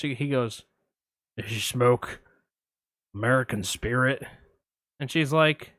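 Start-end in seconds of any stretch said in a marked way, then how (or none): 1.4-4.2 lurk low like a shady caller; a hint of Buffalo Bill, low; creepy caller. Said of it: "I'm gonna play both parts".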